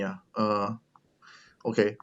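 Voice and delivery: ordinary talk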